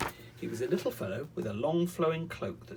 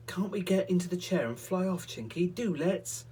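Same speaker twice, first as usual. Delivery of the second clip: put-on pleading boy; 7 semitones higher, 4 dB louder than usual. Peter pleads.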